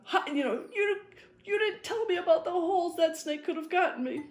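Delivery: wife scolds him about the holes, half-laughing mock whine; mock upset lady. A hint of a flustered refined lady. Retelling the angry wife's complaint.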